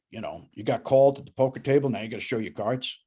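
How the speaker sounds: usual speaking voice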